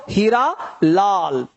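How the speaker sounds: हीरा with a long, stretched ee; हीरा Lal with a held-out ee; drawn-out ee. Shown as a demonstration. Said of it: "थोड़ा सा खींच रहा है".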